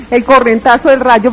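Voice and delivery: normal talking voice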